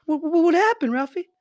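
high, hammed-up alarmed mother; frantic movie mom, played for laughs; mock movie mom. Acting out the movie mother asking Ralphie, mock alarmed. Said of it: "acting A Christmas Story scene".

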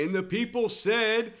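high, slow call-and-response preacher; comic cues the heckler with a high, slow preacher call. Retold or imitated. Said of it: "every time I say".

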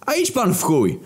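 high, pissed-off snarl at the coach; snarling back. Acted. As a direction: snap back at him, annoyed. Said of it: "warknął w odpowiedzi wkurwiony".

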